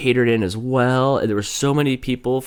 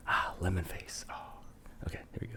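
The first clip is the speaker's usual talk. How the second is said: whispered to herself; breathy unvoiced whisper; 4 semitones lower, 17 dB quieter than usual. Real, not acted. Whispered aside to self.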